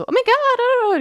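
high-pitched excited squeal of the phrase; exaggerated gushing, drawn out way up high; hyped-up mock excitement; over-excited. Shown as a demonstration. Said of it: "nicht die ganze Zeit so aufgeregt".

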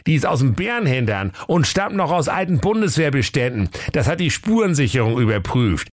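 high-pitched reporting sidekick; comic knight-officer figure; Prinz Meisenherz. A narrator's reading. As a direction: report to the boss in a high put-on voice. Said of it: "Ritter Prinz Meisenherz".

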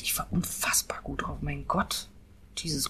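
breathless hushed whisper. Real, not acted. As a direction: drop to an awed whisper. Awed recollection, voice drops away.

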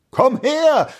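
high, commanding call; loud call. A narrator's reading. Prince summoning the hidden echo, loud call. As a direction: call it out across a gorge. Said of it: "sagte er laut".

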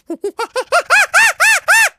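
very high, loud monkey screeching; a full-throated ape call; monkey impression. Shown as a demonstration. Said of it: "Ich zeig das einmal ganz kurz".

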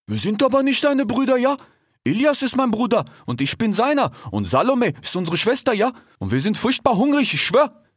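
high, slangy cheetah lad; cheetah Ishak. A narrator's reading. Cheetah rebuffs the fox as no brother, high slangy insistence. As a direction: push the voice up, insistent street talk.

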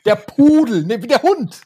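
high, loud, laughing over-explanation; insistent joke-teller. Acted. Teller explains a joke nobody laughed at, mock-desperate. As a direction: hammer the punchline home, hammed-up and high. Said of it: "weil's keiner gehört hat".

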